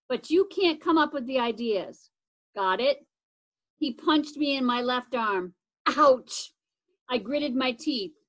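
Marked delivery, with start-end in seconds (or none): 6.0-6.5 sharp yelp of pain; pained ouch. Acted. Brother punched in the arm, yelps in pain. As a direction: cry out as the punch lands.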